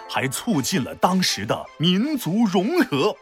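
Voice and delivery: usual speaking voice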